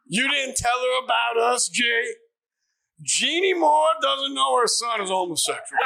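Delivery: high, loud theatrical bellow; hammed-up star impression, shouted; Al Pacino. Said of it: "And he just goes".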